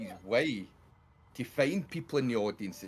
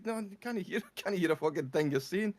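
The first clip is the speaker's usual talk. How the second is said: retold shouting; 9 semitones higher than usual. Comedian shouting down the mic at deaf audience, half-hearted mock shout. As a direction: imitate shouting down a mic, amused. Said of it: "So he starts shouting down the mic".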